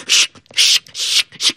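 breathy shh shh of swimming; whispered shushing. Acted. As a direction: hush it like water splashing. Frogs swimming sound.

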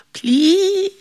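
high, drawn-out begging; pitiful plea. Acted. Devil stuck in bush begs John, pitiful. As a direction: squeak out a pitiful appeal.

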